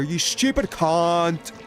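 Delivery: usual speaking voice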